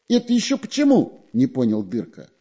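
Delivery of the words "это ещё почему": high, squeaky little spy; high sneaky spy voice. A narrator's reading. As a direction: pitch him up like a sly weasel.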